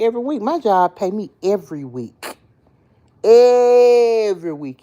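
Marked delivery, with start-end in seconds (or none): 3.2-4.8 high, loud and slow, savoring each word; drawn-out delight. Real, not acted. Delighted about weekly pay, high and drawn out. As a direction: stretch it out like the best news ever.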